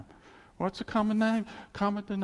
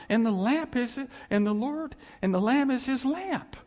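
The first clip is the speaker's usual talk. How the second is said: scripture read with fervour; 7 semitones higher than usual. Acted. Pastor reading Revelation to the congregation, fervent. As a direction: proclaim the verse with mounting passion.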